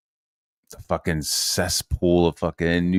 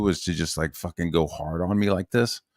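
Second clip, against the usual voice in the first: usual speaking voice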